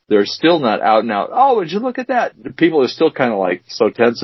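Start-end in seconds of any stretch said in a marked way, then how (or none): 1.3-2.3 high-pitched mock amazement; exaggerated oohing passer-by; amazed onlooker. Imagined impressed onlooker's reaction.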